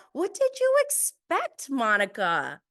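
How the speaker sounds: high, loud disbelieving question; exasperated. Real, not acted.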